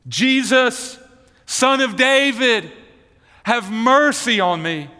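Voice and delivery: crying out. Acted. Blind beggar calls to Jesus on the road, crying out. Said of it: "he began to cry out".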